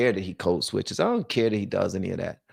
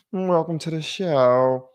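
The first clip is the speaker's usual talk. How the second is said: slow, drawn-out show-host welcome; hammed-up slow emcee greeting; mock host; 5 semitones higher, slower than usual. Shown as a demonstration. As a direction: play the TV announcer, slowly.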